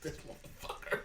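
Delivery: voiceless words collapsing into laughter; too tickled to get the words out; helpless laughing. Real, not acted. Cracks up retelling the comeback.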